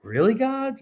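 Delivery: retold, half-joking "really?" to the sky; incredulous disbelief. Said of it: "I'm like".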